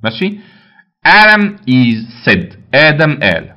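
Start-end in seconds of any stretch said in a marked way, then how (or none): 1.1-2.6 slow dictation. Shown as a demonstration. Teacher reads exercise slowly.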